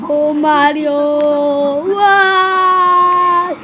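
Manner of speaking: long drawn-out mock sobbing wail; melodramatic wailing. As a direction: wail in hammed-up despair, stretching each word. Luigi told they will die.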